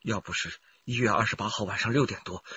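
ordinary talk

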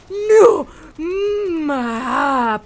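high, loud anguished "no" and wail; dismayed cry. Real, not acted. Player wailing at her own blunder, dismayed cry. Mistake in game, teammates lost.

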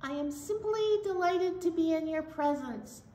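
high, sweet puppet voice for a friendly zebra. Pretend. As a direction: greet her as a cheerful puppet zebra. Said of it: "Zebra would like to be our story time friend".

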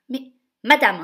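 very loud cut-in; intervening duke. Acted. Grand duke interrupting the stepmother, loud. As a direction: interrupt firmly. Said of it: "intervient le grand-duc".

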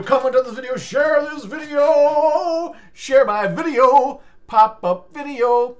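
slow, high, loud stretched-out yell; drawn-out shout. Acted. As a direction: stretch every word out and belt it. Drawn-out shouted call to share.

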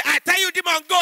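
high, forceful exorcist command; commanding pastor. Retold or imitated. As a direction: order the demon out.

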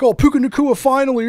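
loud, high mock complaining; imitating Ryan. Host mimics Ryan griping about his player, mock complaining. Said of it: "he was complaining".

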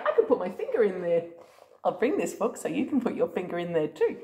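ordinary delivery

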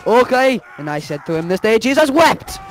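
high, excited Irish exclamations; over-the-top Irish brogue; mock Irish. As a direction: cry out in hammed-up Irish, preacher-like. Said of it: "speaking Irish".

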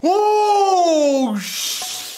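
high, loud startled oh; shocked shout. Real, not acted. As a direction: blurt it out in alarm. Shocked by launch hitting face.

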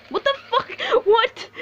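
high-pitched what-the-hell shriek; stunned outburst; shocked disbelief. Real, not acted. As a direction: caught totally off guard, voice jumps way up. Sudden baffling death in game.

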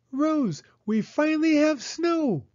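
put-on very high, thrilled child's voice; Penny telling her doll Rose, high and overjoyed; delighted little girl. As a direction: tell the doll with pure joy. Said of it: "said Penny".

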